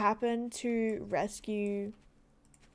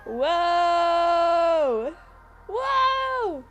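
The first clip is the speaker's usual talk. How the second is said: loud, high astonished whoa; amazed whoa; 7 semitones higher, 8 dB louder than usual. Real, not acted. Streamer watching a magical scene, shouting in amazement. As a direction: gasp it out in wonder. Amazed reaction to game visuals.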